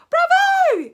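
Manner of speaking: very high, very loud cheer; yelling. Acted. Audience of witches cheering, very high and loud. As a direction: crowd cheer. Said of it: "yelled the audience".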